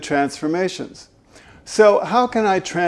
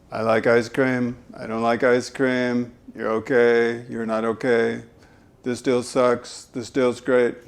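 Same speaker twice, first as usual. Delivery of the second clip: low, flat-pitched one-note delivery; monotone; 5 semitones lower, 5 dB quieter than usual. Shown as a demonstration. Voice coach demoing the boring monotone speaker. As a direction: drone every line on one note. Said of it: "Mister and Missus Monotone".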